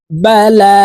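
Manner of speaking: বালা with the long a stretched out slowly; long drawn vowel. Shown as a demonstration. Teacher drawing out বালা for viewers to repeat. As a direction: stretch the first a of বালা by one alif. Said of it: "আপনারা আমার সঙ্গে বলে শিখে নিন".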